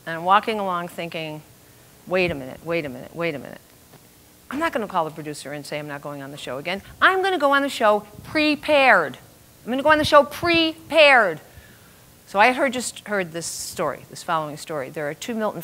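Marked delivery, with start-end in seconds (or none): 2.1-6.8 low, softer thinking-aloud voice; inner thought. Retold or imitated. Woman walking along, thinking to herself, low and soft. 7.0-11.4 PREPARED lifted high and stressed; emphatic resolve. Retold or imitated. Storyteller vowing to herself, "prepared" pitched high and emphatic.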